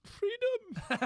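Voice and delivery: Braveheart-style Scottish battle cry, high and loud; mock Scottish yell; Scottish accent. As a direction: belt it like a Scottish war cry. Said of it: "Say it in a Scottish accent".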